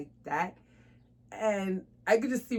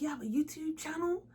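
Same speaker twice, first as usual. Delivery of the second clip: mock amazed stranger; 9 semitones higher than usual. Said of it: "most people that's like".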